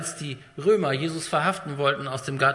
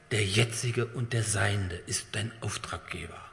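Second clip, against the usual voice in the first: slow, low whisper; 6 semitones lower, 5 dB quieter than usual. Real, not acted. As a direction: let the awe drop into a whisper. Climax of sermon point.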